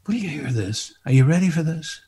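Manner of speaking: ordinary talk, nothing marked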